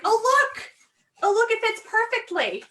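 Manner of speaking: high, loud mock-delighted exclamation; excited discovery. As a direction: squeal it a little, drawing out the delight. Reenacts delight at bag fitting.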